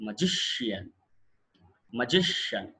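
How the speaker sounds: Magician with GI stressed, falling onto a light shian; penultimate stress. Shown as a demonstration. Teacher models magician for class, stress on GI. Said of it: "ఇప్పుడు ఎలా పలకాలి".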